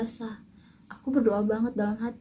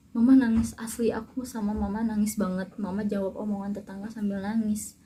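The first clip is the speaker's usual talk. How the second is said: ordinary talk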